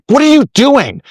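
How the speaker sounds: high angry shout; sudden furious high-pitched yell; blowing up. Retold or imitated. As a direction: yell it, losing your temper. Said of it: "We all blow up and I say".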